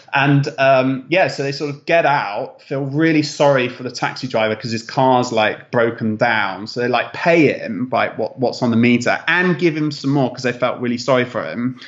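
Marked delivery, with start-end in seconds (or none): none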